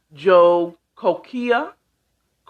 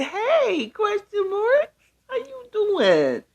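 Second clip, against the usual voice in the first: high squeaky quote; slower than usual. Retold or imitated. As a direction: read a viewer's message in a high, slow voice. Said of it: "That's what he said".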